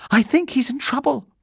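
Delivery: high-pitched worried call; urgent cry. A narrator's reading. Judy rushing upstairs, calling back to Jonathan in alarm. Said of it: "cried Judy over her shoulder".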